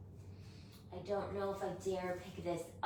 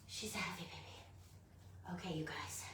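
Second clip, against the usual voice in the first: whispering; 14 dB quieter than usual. Real, not acted. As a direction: whisper it while holding something heavy. Repeats line, fading to whisper.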